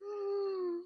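high, squeezed sigh of mock despair; high strained sigh. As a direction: sigh like you cannot hold it any longer. Comic desperation.

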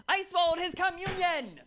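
high, loud laughing exclamation; mock outrage. Comic shrieking her punchline at the crowd, laughing. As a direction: squeal it out through laughter.

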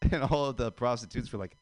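ordinary delivery

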